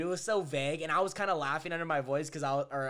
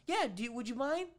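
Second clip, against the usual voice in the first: higher coaxing mock voice; lightly voiced saleswoman; imitating the lady; 9 semitones higher than usual. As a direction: ask sweetly like her. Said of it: "And she goes".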